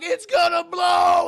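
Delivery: very high, loud, slow warning yell; panicked shout. Retold or imitated. Workers shouting as the tank is about to burst. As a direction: scream the warning. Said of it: "Everyone's like".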